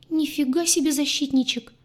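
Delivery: high-pitched, flabbergasted exclamation; astonished, pitched right up; stunned squeak. A narrator's reading. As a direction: squeak it out in shock. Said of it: "ошалело пискнула".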